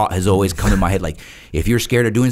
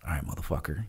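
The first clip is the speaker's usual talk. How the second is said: muttering to myself; 4 semitones lower, 13 dB quieter, faster than usual. Retold or imitated. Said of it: "now I'm talking to myself".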